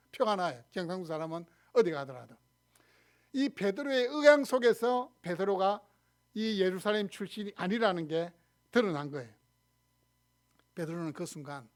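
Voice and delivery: normal talking voice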